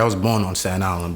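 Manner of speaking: ordinary talk, nothing marked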